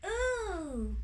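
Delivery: high, drawn-out fairy ooh; long high ooh of delight. A narrator's reading. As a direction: coo with pleasure, high up.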